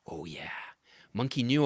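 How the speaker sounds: usual speaking voice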